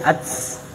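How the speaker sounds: "ats" whispered with breath after the t; wrong t as a hissed "ats". Shown as a demonstration. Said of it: "Itu T S".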